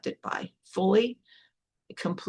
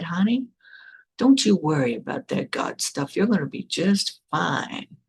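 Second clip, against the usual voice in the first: put-on soothing Southern accent. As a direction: reassure in an imitated Southern accent, stretching the ending. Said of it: "soothing Southern accent".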